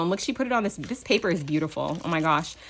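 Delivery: ordinary delivery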